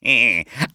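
hammed-up evil laugh; horrible villain laugh. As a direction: cackle like a storybook villain. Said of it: "She laughed horribly".

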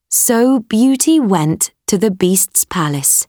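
usual speaking voice

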